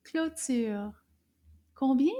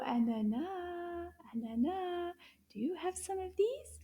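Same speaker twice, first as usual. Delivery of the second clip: coaxing softly; 5 semitones higher, 7 dB quieter than usual. Acted. Coaxing puppet.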